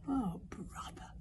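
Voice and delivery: slow, soft whisper of dismay. A narrator's reading. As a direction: whisper it helplessly. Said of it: "whispered Fishlegs".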